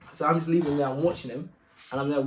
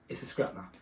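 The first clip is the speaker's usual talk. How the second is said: hushed aside; 8 semitones lower, 9 dB quieter than usual. Retold or imitated. Realising a fight is coming.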